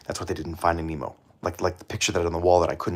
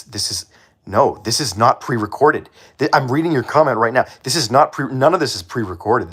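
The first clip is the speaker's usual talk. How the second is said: insistent; 5 semitones higher, 6 dB louder than usual. Real, not acted. Insisting to commenter.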